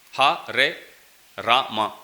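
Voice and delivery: HARE RAMA split as Ha, re, Ra, ma; syllable by syllable. Shown as a demonstration. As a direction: separate each syllable of "Hare Rama" clearly. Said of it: "every word is two syllables".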